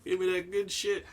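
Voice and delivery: high, fast, craving addict voice; crackhead voice. Pretend. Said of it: "Sounds like a crackhead".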